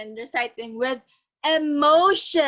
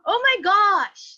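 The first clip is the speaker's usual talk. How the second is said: higher, louder, slow "Oh my gosh"; surprised; 7 semitones higher, 6 dB louder than usual. Shown as a demonstration. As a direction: gasp it out in astonishment. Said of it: "expressions that show surprise".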